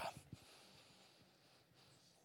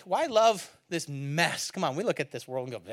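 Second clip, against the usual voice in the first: ordinary talk, nothing marked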